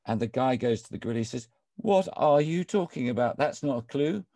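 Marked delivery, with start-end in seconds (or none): 1.8-4.2 higher, louder acted exasperation; exasperated protest. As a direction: play a baffled pupil protesting to his teacher. Man protests gorilla's riddle-like story.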